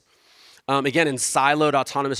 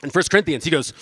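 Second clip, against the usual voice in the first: usual speaking voice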